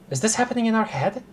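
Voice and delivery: high, fast bewildered question; alarmed disbelief. Real, not acted. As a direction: rush it out in confusion. Reeling from the strange call.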